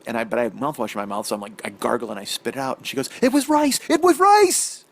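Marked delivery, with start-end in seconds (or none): 3.2-4.8 high, loud triumphant yell; excited shouting. Retold or imitated. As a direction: shriek it like you won. Wife shouts her discovery.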